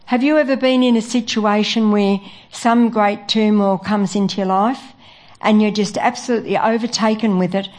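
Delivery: ordinary talk, nothing marked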